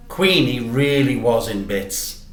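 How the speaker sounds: ordinary delivery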